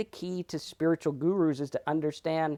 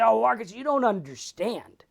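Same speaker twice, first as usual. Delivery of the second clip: high, louder pleading mock voice; whiny objector; 8 semitones higher, 7 dB louder than usual. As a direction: protest like a spoiled complainer.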